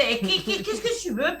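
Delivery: a hint of a Marseille twang, played for laughs; southern French accent. Teasing the radio hosts in a mock southern French accent. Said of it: "je reprends mon accent du sud".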